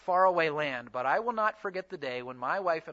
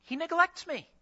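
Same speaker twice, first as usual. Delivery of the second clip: higher-pitched imitation of a crying wife; mild mock plaintiveness; retold tearful complaint; 10 semitones higher than usual. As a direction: quote her hurt complaint, voice lifted. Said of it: "his wife is crying, saying".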